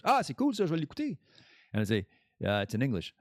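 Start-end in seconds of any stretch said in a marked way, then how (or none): 0.0-1.2 higher, hammed-up French brush-off; mock French listener. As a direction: toss it off with fake cheer, in French. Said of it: "they say that in French".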